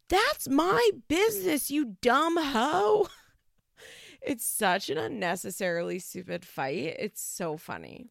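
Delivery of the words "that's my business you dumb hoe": high bratty insult, slow on the last words; hammed-up daughter yelling at mom; mock teen snapping. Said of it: "And Rachel's like".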